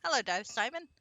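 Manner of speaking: normal talking voice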